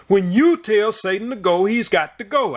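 forceful, thunderous declaration; thundering voice. Retold or imitated. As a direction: let it roll like thunder. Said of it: "I heard the thunder in his voice".

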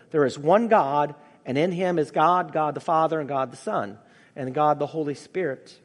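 ordinary delivery

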